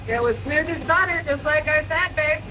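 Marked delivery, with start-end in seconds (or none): none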